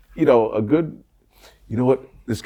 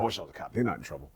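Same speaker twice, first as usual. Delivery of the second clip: muttered thought; 4 semitones lower, 14 dB quieter, faster than usual. Retold or imitated. Inner thought in court.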